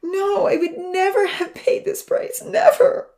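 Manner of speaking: high, slow, loud sobbing cry, NEVER breaking upward. A narrator's reading. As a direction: wail it through sobs. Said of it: "And then he began to sob".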